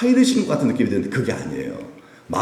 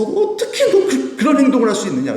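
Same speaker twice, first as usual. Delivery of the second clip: upset rebuke; 13 semitones higher, 4 dB louder than usual. Retold or imitated. Jesus dismayed at believers, high and disbelieving. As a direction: cry out in dismay.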